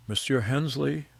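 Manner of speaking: barely containing his excitement; an awed Frenchman greeting a legend, excited; trembling with excitement. A narrator's reading. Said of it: "his voice trembling with excitement".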